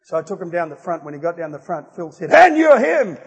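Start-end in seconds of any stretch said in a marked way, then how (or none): 2.3-3.2 very loud, high imitated shout; retold pastor's shout. Pastor pointing out the young man at the front, imitated shout. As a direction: burst out as the pastor singling someone out.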